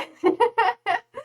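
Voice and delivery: sudden high burst of laughter. Real, not acted. Laughs at antinatalist topic.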